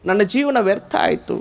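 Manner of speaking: keen it out like a man mourning his wife; hammed-up grieving husband; mock loud wailing. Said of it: "ಜೋರ್ ಅಳಾಕತ್ತ".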